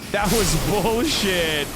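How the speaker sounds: slow, stretched, half-laughing whine; laughing drawn-out complaint. Real, not acted. Streamer whining at the unfair boss, slow and half-laughing. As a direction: drag the words out through a laugh.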